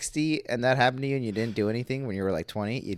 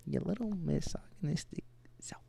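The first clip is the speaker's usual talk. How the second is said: whispering; 6 semitones higher, 9 dB quieter than usual. Acted. Repeats the tease.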